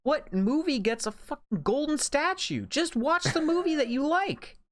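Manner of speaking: high, heated rant; fired up and ranting against award shows; getting heated. Real, not acted. A podcaster ranting to his co-host about award shows, heated and high. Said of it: "I'm getting heated".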